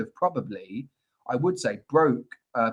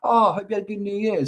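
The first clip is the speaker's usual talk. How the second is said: bright, quick and higher: Oh, I hope you had a good New Year; cheery greeting; 6 semitones higher, faster than usual. Retold or imitated. To someone he likes, a bright, eager greeting. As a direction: gush it at a friend. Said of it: "someone that I like and said".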